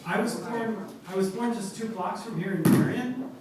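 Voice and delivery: mic pulled in close. Real, not acted. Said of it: "Please hold the mic closer to your mouth".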